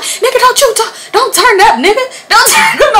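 high, loud warning with laughter creeping in; mock aggressive yelling. Sister warns the man off after the cup throw, high mock yelling. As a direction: act out a sister squaring up.

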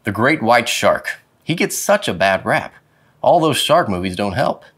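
usual speaking voice

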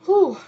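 high, loud breathy whew; real release of strong feeling; emotional exhale. Real, not acted. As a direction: puff it out, overwhelmed. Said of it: "that just came through so strong".

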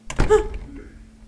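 sharp intake of breath; startled gasp. Real, not acted. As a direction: gasp in sudden fright. Startled in a horror game.

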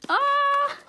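high drawn-out squeal of delight; overwhelmed squeal. Real, not acted. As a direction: squeal because words fail. Overwhelmed, out of words.

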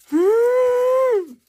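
a long high squeal of delight; high excited squeal. Real, not acted. Squealing over flamingo stickers to her viewers. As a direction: squeal like you just saw something you love.

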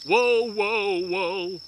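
ordinary talk

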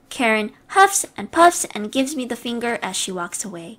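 usual speaking voice

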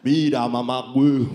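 a hint of a Mexican movie gangster; Mexican accent. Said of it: "hablando de ese acento".

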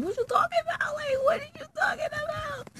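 very high exaggerated squeal; high-pitched mock outcry. Storyteller demanding an explanation from her friend, high mock shriek. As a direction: shriek it in play-acted disbelief.

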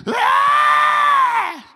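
very high, loud drawn-out scream. Retold or imitated. Heard from the next room, long piercing scream.

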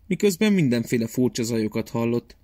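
usual speaking voice

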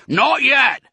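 very high, loud holler; yelled reply across a distance; shouted. A narrator's reading. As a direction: shout the answer back. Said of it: "shouted Boggis".

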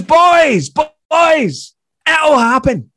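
loud, high-pitched imitated holler; shouting after them. Famous comedian encouraging two departing fans, shouting after them. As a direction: call it down the street as a famous comedian. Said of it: "he then shouts after us".

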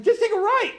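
high, pleading yell of a desperate driver; urgent high yell. Retold or imitated. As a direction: driver yelling at the stuck traffic, high pleading call. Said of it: "I'm like".

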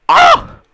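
sudden high yelp. Real, not acted. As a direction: yelp out sharply. Sudden yell.

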